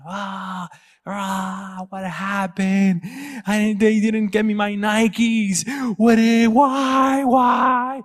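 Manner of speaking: high, slow mock wailing; imitated crying son. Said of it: "I hear my son crying".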